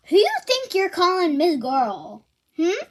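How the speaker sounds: mock-offended kid talking back; indignant comeback. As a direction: act out a sassy quarrel retort. Retold quarrel with stepmom.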